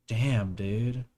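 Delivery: ordinary talk, nothing marked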